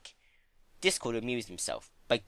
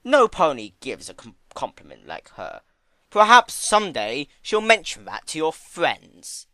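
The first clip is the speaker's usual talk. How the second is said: dry sarcasm; 4 semitones higher, 10 dB louder than usual. Acted. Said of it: "observed dryly".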